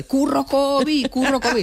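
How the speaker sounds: high, stretched-out retold yell; grito imitado. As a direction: re-enact a drawn-out shout from sleep. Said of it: "yo grité". (grito agudo y alargado, imitado)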